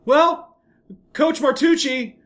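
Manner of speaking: put-on high, proud little boy voice; boy innocently repeating coach's curse to dad; innocent kid. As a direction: say it like a kid who knows no better.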